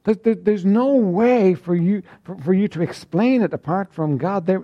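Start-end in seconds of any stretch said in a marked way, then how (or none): none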